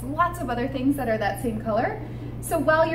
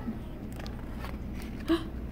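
gasp; 12 dB quieter than usual. Pretend. Storytime suspense before reveal.

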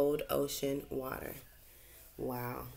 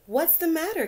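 put-on much higher, louder child-fish voice; little fish asking; 13 semitones higher, 7 dB louder than usual. Gilbert the fish asks the crying remora, high and gentle. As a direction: ask kindly as a small fish.

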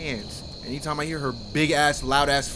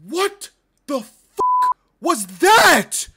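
slow, loud, high-pitched disbelief; shocked outburst; 9 dB louder, much slower than usual. Real, not acted. Player reacting to a jump scare, shocked shout. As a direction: space the words out in alarm.

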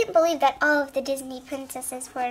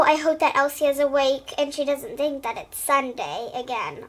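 plain reading voice

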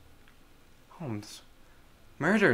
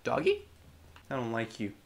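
ordinary talk

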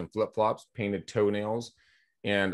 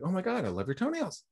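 imitating a gushing woman, pitched much higher; gushing stranger; 11 semitones higher, 4 dB quieter than usual. Stranger on the street spotting his toenails, in a high, gushing mock voice. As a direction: squeal it lightly like an admiring stranger.

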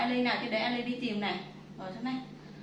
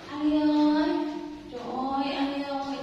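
calling out; 2 semitones higher, 6 dB louder than usual. Real, not acted. Mother calling the mother cat, loud call. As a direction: call the cat from across the room.

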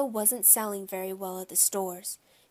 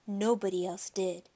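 usual speaking voice

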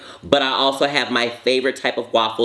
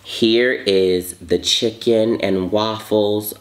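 drawn-out slow presentation; slow reveal; 3 semitones lower, much slower than usual. Real, not acted. Presenting the chicken and waffles to viewers, slow reveal. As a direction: savor each word as you unveil it.

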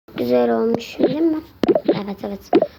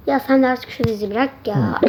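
usual speaking voice

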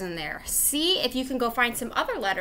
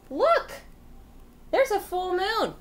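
higher, with a gasp of wonder; excited reveal; 9 semitones higher than usual. Acted. Teacher pointing out the full moon to kids with wonder.

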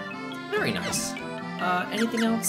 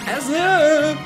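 strained shout; 9 dB louder than usual. Real, not acted. Talk interrupted by fighting.

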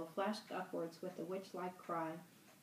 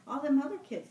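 higher, louder worried burst; anxious outburst; 7 semitones higher, 10 dB louder than usual. Acted. Fat boy frets to Ralph about the missing kids, anxious burst. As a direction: blurt it out, fretting.

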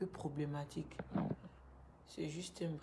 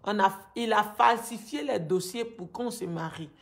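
raised, agitated pleading; upset; 2 semitones higher, 7 dB louder than usual. Real, not acted. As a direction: let the anger pour out louder. Angry account of forced marriage.

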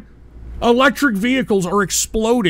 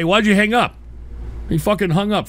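normal talking voice

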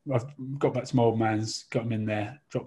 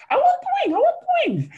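put-on childish whine; 7 dB louder, faster than usual. Drunk friend grabbing turkey wing.

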